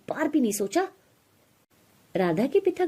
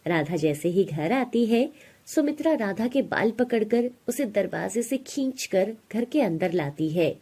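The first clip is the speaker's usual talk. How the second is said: plain reading voice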